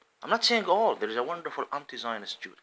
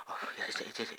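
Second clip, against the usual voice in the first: mock whisper; 9 dB quieter, much faster than usual. Mocking agreement in whisper.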